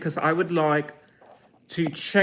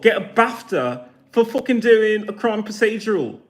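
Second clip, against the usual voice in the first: ordinary talk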